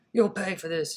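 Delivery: loud furious bellow from the burly patron. A narrator's reading. Burly patron bellows after the fleeing thief, loud. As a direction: roar the threat after him. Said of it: "he bellowed".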